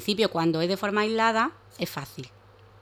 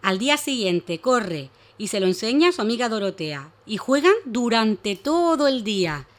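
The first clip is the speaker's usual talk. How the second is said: story narration; 3 semitones higher, 5 dB louder, slower than usual. Acted. Reads a story to children.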